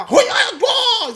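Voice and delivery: high, loud fake Chinese bark; mock Chinese cook shouting to a coworker. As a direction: yell it like a cook at the grill. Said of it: "Ele olha pro outro lá".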